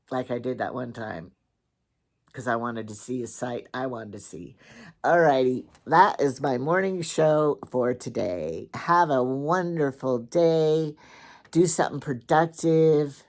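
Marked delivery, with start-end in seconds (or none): 5.0-13.2 show sign-off. Acted. Signs off morning show.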